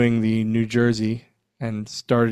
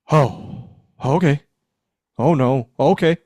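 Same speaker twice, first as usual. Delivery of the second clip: higher, louder shocked okays; stunned reaction; 4 semitones higher, 5 dB louder, slower than usual. Retold or imitated. Told he is the son, startled and raised. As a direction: caught off guard by big news. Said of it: "And I go".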